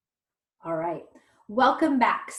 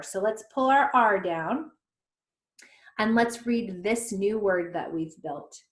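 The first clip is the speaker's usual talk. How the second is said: normal talking voice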